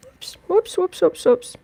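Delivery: high playful whoops as the dog climbs. Real, not acted. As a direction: react as the dog slips. Dog scrambling onto lap.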